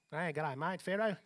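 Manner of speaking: playful Aussie greeting; mock Australian accent. Preacher joking about how Pharaoh sounds, mock Australian accent. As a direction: put on a joking Australian twang. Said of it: "It sounds like Australian".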